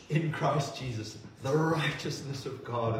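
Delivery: ordinary talk, nothing marked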